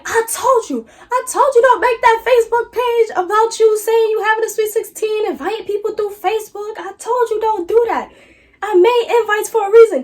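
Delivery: high, loud nagging mom voice; scolding mom. Retold or imitated. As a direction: lay into her like a fed-up mother.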